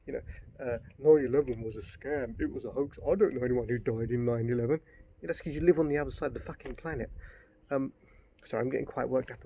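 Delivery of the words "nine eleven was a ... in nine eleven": lightly voiced smug conspiracy believer; mocking conspiracy theorist. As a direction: parrot a 9/11 denier with mild scorn. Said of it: "the real people who go on about".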